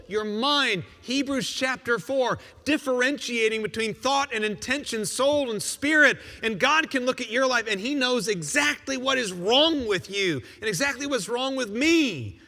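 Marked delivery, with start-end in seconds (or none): none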